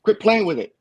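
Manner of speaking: high-pitched imitation of an Australian friend; Australian accent. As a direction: burst in with his Australian accent. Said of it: "with that accent of his".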